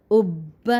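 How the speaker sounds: "اب" as ubba, pressed and held on the b; emphatic geminated ub-ba for "اب"; pressed doubled consonant. Shown as a demonstration. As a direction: lean hard into the double b of "اب" after a short u. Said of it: "زور لگا کے".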